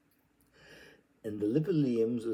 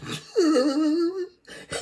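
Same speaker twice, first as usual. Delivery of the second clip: long shrill laughing cry, very loud; a loud high laugh close to a cry; high wail-laugh; 11 dB louder than usual. Acted. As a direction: let out a high howling laugh. Wild laugh during reading.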